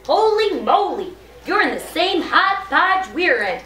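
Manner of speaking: high, animated exclamation; excited. A narrator's reading. Principal finds the nurse in the same mess, excited. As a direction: burst out as an astonished storybook principal. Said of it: "I'm making my voice sound excited".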